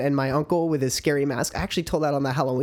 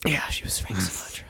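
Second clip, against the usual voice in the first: whispering; 7 semitones lower, 6 dB quieter than usual. Pretend. Joking confession.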